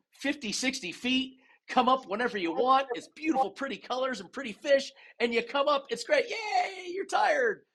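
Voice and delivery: high, singsong happy-talk; sugary mock cheer. Diver reassuring recreational friends he won't scare, sugary mock cheer. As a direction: sell it like a children's outing, mockingly chirpy.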